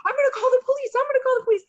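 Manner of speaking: very high, loud panicky clerk; mock panicking clerk. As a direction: threaten the police in a panic. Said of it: "He's like".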